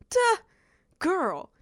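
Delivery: a high, loud, sassy "Duh". Retold or imitated. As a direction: fling "Duh, girl" like an obvious retort. Friend's retort voiced in story.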